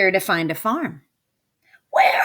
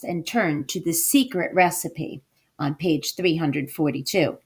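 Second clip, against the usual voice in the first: plain reading voice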